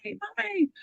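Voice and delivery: high-pitched, mock child's cry for mother; retold scream. Mother retelling her daughter's night-time scream, high mock cry. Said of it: "Gina started screaming".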